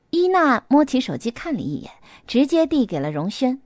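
ordinary delivery, nothing marked

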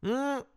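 high, drawn hum; pleased hum. A narrator's reading. Chief responds to his detective, high hum. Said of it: "包康嗯了一声".

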